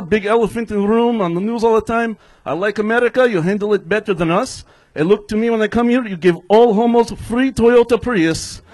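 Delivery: stand-up comic's put-on Russian accent; hammed-up Russian English; fake Russian accent. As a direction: play a Russian immigrant with a thick accent. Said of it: "somebody's doing a fake Russian accent".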